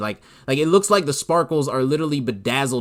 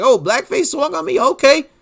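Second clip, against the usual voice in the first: mock ready-to-fight Chess; 12 semitones higher, 5 dB louder than usual. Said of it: "He was like".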